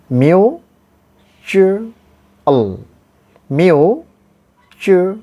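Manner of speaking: MUTUAL split into myoo, chu, ul with long pauses; syllable by syllable. Shown as a demonstration. Teacher tells it again, syllable by syllable. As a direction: say "mutual" piece by piece, lifting the first part. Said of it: "I am telling again".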